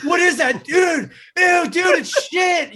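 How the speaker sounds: high mock shouts of disgust; kids yelling. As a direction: yell it like grossed-out kids. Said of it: "they were like".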